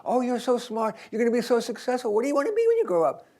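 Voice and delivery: put-on high, fast gushing to a child; gushing adult. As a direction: coo excitedly at a clever little kid, pitch climbing. Adults gushing at little kids.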